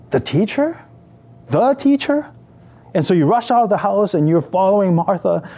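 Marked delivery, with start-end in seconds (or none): 0.1-2.3 rising, higher-pitched incredulous question; startled disbelief. Acted. Mary hears the teacher is calling her, startled disbelief. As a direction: echo it in surprise, climbing higher on the repeat.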